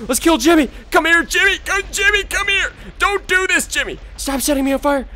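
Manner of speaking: high-pitched, excited hollering; frantic chase calls. Real, not acted. Gamer chasing Jimmy while burning, frantic. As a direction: squeal after a fleeing target.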